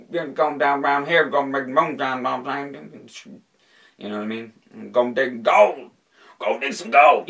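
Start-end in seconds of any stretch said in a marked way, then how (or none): 0.1-3.1 loud, higher-pitched hillbilly drawl, words mashed together. Shown as a demonstration. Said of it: "probably the hick". 4.9-7.3 high, very loud hillbilly holler. Shown as a demonstration. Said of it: "probably the hick".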